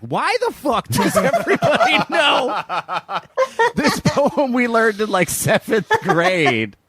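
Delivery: high-pitched, incredulous retold outburst breaking into laughter; retold bewilderment through laughter; laughing disbelief. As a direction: crack up while acting out bafflement, stretching the last words. Retelling own baffled reaction, laughing.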